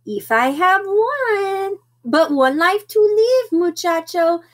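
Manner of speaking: high-pitched, hammed-up Spanish accent; playful put-on Spanish accent, voice high. A narrator's reading. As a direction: declare it grandly in a mock Spanish accent. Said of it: "using his very best Spanish accent".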